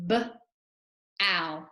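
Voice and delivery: each sound said separately: L, ow, r. Shown as a demonstration. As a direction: sound out L, ow, r one by one, like a teacher. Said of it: "Let's go through and say the sounds".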